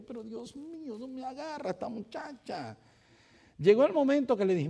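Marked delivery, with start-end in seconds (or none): 0.0-2.8 soft, exasperated sigh of a line; muttering in exasperation to himself; exasperated aside. Retold or imitated. Pastor on the phone, exasperated under his breath. Frustrated on customer service call.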